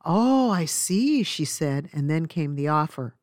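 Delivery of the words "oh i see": knowing busybody. Retold or imitated. Nosy stranger replies knowingly.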